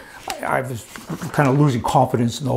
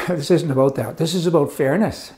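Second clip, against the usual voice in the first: ordinary talk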